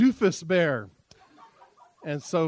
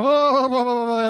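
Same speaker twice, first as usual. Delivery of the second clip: loud, higher panicked babble of the crowd; startled hubbub; 5 semitones higher, 8 dB louder than usual. Acted. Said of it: "And they go".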